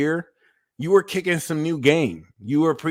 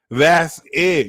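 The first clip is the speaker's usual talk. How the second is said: ordinary delivery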